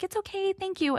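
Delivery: imitating daughter, high and soft; retold polite, embarrassed reassurance in a high voice; gracious embarrassed daughter. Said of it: "so gracious and also very embarrassed".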